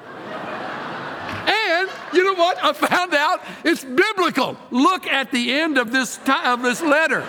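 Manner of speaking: high-pitched, giddy preaching; excited revelation. Real, not acted. Pastor revealing that it's biblical, high and excited. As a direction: crow it high, as a great discovery.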